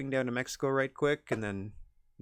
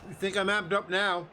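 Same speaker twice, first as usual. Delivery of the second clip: wrestler taunt; 4 semitones higher than usual. Acted. Promo taunt after match.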